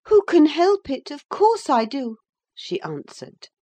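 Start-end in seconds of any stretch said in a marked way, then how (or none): 0.1-2.2 high, loud retort; high-pitched, emphatic protest; Catherine. A narrator's reading. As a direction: fire it back in a high young lady's voice. Catherine retorts that she loves Edgar.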